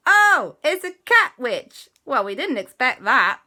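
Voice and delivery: the corrected, still surprised take; milder surprise. Shown as a demonstration. Children meeting the cat witch, corrected surprised take. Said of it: "They went".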